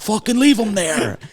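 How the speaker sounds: mock-holler it like a fed-up dad; dad's mock yell. Voicing dad over walkie-talkie.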